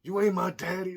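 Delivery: loud, high, defiant yell at a stepdad; kid yelling. Retold or imitated. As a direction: shout it like a furious eleven-year-old.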